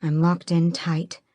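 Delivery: plain reading voice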